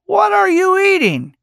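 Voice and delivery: high, loud, deliberate "What are you eating?"; playfully insistent quizzing; insistent question. A narrator's reading. Old man repeats question pointedly.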